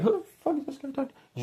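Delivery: high, fast mock-bewildered viewer voice; squeaky imitation of a puzzled viewer; confused viewer. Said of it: "being like".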